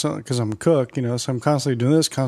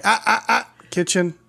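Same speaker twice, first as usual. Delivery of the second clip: scolding; 8 semitones higher than usual. Retold or imitated. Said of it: "I'm like".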